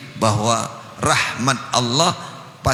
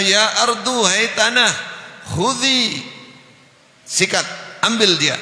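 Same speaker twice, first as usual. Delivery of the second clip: angry command; 9 semitones higher than usual. Acted. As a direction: order the ground to swallow him, furious. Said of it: "Nabi Musa akhirnya marah". (perintah marah dengan suara tinggi)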